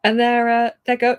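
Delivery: normal talking voice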